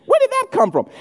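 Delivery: high-pitched incredulous squeal; high, squeaky, bewildered protest. Acted. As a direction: react in baffled disbelief, voice shooting up. Incredulous reaction to put-on voices.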